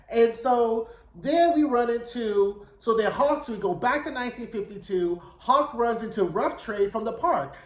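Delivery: normal talking voice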